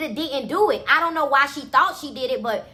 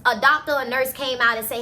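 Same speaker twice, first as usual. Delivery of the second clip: ordinary delivery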